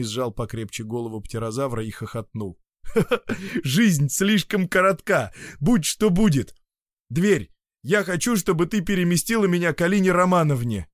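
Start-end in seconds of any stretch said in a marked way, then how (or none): 2.8-3.6 hearty laugh. Acted. Evgeny laughs holding head. 3.6-10.9 bold declaration. Acted. Evgeny's triumphant command.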